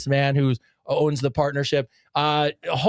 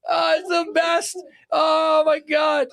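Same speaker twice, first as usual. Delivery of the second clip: shrieking laugh into delight; 11 semitones higher, slower than usual. Real, not acted. Cracking up to listeners over the catchphrase, shrieking high. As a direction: shriek of laughter, then squealed delight, much higher.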